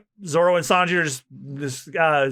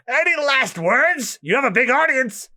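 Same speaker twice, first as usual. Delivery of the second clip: much higher, slow mocking voice; taunting Buggy; 11 semitones higher, 4 dB louder than usual. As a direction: gloat over the prisoner. Buggy taunts Luffy at execution.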